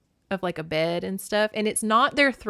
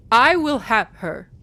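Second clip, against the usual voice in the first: slow, louder, set apart from her voice; defiant vow; 3 semitones higher, 9 dB louder than usual. Acted. Demeter vows to Zeus she'll have her daughter, slow and defiant. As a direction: stake your claim, word by word.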